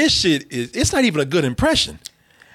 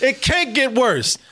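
usual speaking voice

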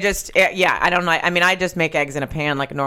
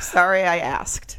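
dry sarcastic; slower than usual. Retold or imitated. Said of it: "And I wrote back".

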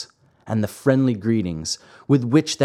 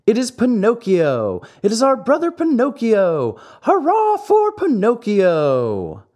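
high, loud cheering, slowing on the hurrah; joyful cheering; 12 semitones higher, 4 dB louder than usual. A narrator's reading. The marionettes greet their wooden brother with loud cheers. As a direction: cheer him in like a hero.

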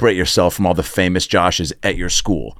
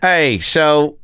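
put-on country accent; 6 semitones higher than usual. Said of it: "Do it in a country accent".

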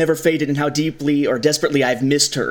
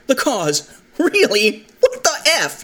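rising, incredulous retort, climbing higher each line; mock incredulous; 10 semitones higher, slower than usual. Podcaster voicing Jyn scoffing at Saw, incredulous. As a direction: throw it back like you can't believe it.

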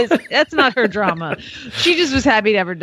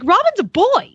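put-on high little-boy voice; son Lucas; 12 semitones higher than usual. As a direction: play the four-year-old, matter-of-fact. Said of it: "he's like".

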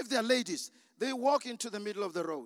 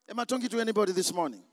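usual speaking voice

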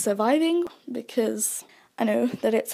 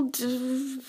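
ordinary delivery

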